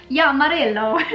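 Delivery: "Yummarillo" in a lilting mock Italian accent. Shown as a demonstration. Vlogger answering a follower's Instagram question, playful Italian accent. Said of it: "say it in an Italian accent".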